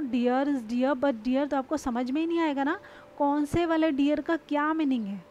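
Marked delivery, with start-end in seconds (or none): 0.0-1.5 dear is dear but dear, spelt out slowly; slow example again. Shown as a demonstration. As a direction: say each "dear" slowly, one by one. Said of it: "अब अगर मैं आपको बोलूँ". (धीरे धीरे उदाहरण)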